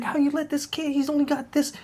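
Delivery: high-pitched scolding dad impression; furious father chewing out his son; angry dad. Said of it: "he's like".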